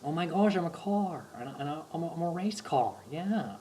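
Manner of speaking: amazed surfer-dude wonder, high and breathless; Owen Wilson impression. Mocking a male model's awe at his own adventure, amazed impression. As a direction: act wide-eyed, surprised by everything. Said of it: "He just always sounds surprised".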